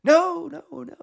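high shout, echoed fainter; mock echo of a high yell; yelling back with echo. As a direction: call up from a pit, fading on each repeat. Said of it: "Daniel yells back".

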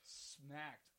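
slow emphasis. Real, not acted. Repeating for emphasis.

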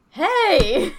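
loud, high, drawn-out call; playful yell. Retold or imitated. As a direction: imitate a fox hollering after its playmate. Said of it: "like, yelled again".

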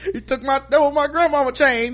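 high, loud, whining mock complaint; whiny wronged man. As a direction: wail about the stolen chain. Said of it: "ran off like Fred".

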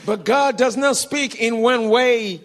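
loud, deliberate declaration; loud preaching. Retold or imitated. Preacher correcting the young man's idea, loudly. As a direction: drive each word out to the crowd. Retells preacher addressing the crowd.

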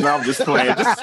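laughing. Real, not acted. Joking after teasing claim.

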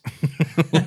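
amused laugh after a cheeky line. Real, not acted. Laughs at own blunt joke.

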